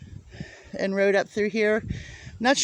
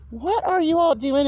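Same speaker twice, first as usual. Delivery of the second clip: higher, curious pet voice; talking to hens; 6 semitones higher than usual. Real, not acted. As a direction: ask the hens sweetly.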